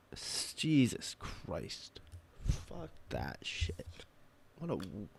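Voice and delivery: real low, slow whispered cursing; drawn-out, exasperated whisper under the breath; disgusted hush. Real, not acted. Card opener cursing an ad card, disgusted hush.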